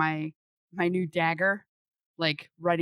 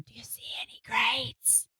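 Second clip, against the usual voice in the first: stage whisper; 5 semitones higher than usual. Acted. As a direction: whisper it so all can hear. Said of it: "I'll kind of stage whisper".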